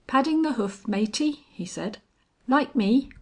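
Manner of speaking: a hint of a chummy tramp; cockney tramp. A narrator's reading.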